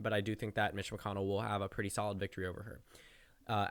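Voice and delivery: ordinary talk, nothing marked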